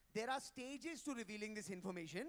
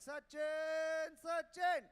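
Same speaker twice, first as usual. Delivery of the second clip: louder, higher joyous shout of a name; ecstatic cheer; 7 semitones higher, 8 dB louder, much slower than usual. Retold or imitated. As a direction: cheer like a fan lifting his hero. Hugging Dhoni, cheering Sachin.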